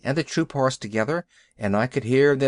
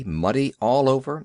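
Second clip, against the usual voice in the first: usual speaking voice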